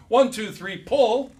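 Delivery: count-off call. Shown as a demonstration. Calling a crew to pull.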